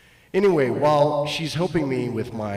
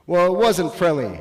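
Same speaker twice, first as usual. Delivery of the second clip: normal talking voice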